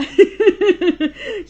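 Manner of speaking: high burst of loud laughter. Real, not acted. Father's joke about preachers.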